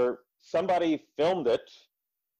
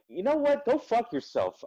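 defiant retort; 11 semitones higher than usual. Retold or imitated. Venting anger at rule enforcers.

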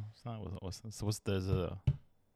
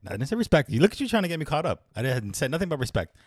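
raised, higher protest; exasperated defensive pushback; heated denial; 7 semitones higher than usual. Real, not acted. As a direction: push back, voice up and indignant. Defending himself, getting heated.

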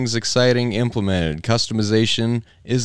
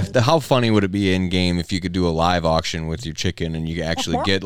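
usual speaking voice

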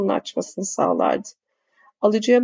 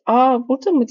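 higher, louder surprised call; 5 semitones higher, 5 dB louder than usual. A narrator's reading. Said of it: "diye bağırdı Nermin". (yüksek sesle şaşkın bir sesleniş)

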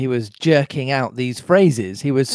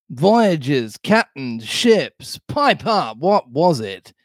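lively, swooping pirate interrogation; badgering pirate Silver; 3 semitones higher, slower than usual. Long John demanding an answer from Morgan, scornful. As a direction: press hard, punching out each item of the list.

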